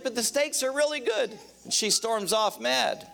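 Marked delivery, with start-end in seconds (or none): 0.0-1.4 high, pleading husband voice; a husband defending his truck-stop pick to his wife, high and pleading. Retold or imitated. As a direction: defend the steakhouse like a hurt husband. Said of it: "He's like".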